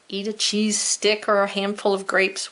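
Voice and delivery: ordinary talk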